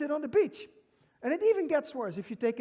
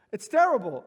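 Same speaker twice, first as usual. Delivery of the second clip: laughing; 9 dB louder than usual. Real, not acted.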